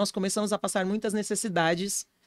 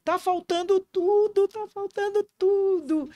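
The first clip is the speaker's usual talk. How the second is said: imitated tearful prayer; 7 semitones higher than usual. Father crying out to God at the altar, imitated tearful high plea. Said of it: "E ele chorava".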